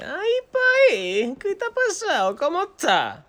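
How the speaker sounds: high, slow, worried granny voice squeaked out; a grandson mimicking his grandmother's fussing on the phone; imitating grandma. Grandson imitates grandmother calling him worried in high voice. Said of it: "Y ella también".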